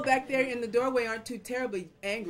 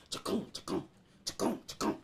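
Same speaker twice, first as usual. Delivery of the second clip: voiceless rhythmic chopping noise; imitated hoe chops; 4 semitones lower than usual. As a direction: chop out the sound with the mouth.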